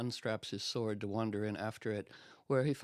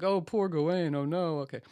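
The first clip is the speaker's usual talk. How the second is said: higher, louder mock pity; mock pitying maidens; 5 semitones higher, 4 dB louder than usual. Court maidens pitying Gawain, higher mock sympathy. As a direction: coo over poor Gawain in mock pity.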